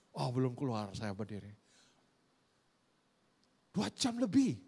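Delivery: normal talking voice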